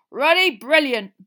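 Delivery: loud, slow booming voice; booming Hagrid. A narrator's reading. Hagrid booming over the crowd, loud boom. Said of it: "boomed Hagrid".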